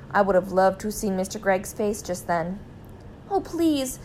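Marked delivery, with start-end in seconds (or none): 3.3-4.0 high, distressed mother's plea; pleading cry. A narrator's reading. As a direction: beg in a raised, anxious voice. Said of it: "cried Missus Gregg".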